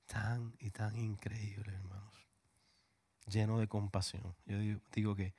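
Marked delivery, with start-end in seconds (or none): 0.1-2.3 slow, hushed whisper; slow reverent whisper; whispered awe. Real, not acted. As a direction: let it fall to a whisper of wonder.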